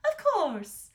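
high, loud queen voice; obliging queen. A narrator's reading. Queen agreeing to fetch the sword, high and loud. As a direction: answer readily in a high voice.

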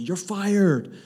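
hammed-up boss saying you're fired; Donald Trump. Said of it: "come in like Donald Trump".

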